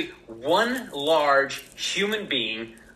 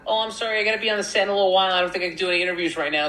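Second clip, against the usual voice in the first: higher, quick apology as the actress; lightly imitated woman begging off, higher voice; Jennifer Aniston; 5 semitones higher, much faster than usual. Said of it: "And she's like".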